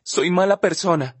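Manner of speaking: a touch of tears in it; crying. Acted. Olivia blaming herself to Justin, mildly tearful. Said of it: "dice sin dejar de llorar".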